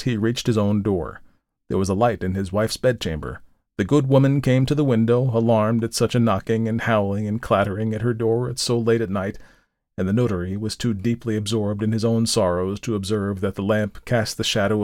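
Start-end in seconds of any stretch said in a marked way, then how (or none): none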